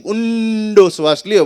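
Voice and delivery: slow, loud, drawn-out instruction; yoga instructor. Retold or imitated. Son quotes Baba Ramdev's yoga command on TV. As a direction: stretch it like a TV yoga guru.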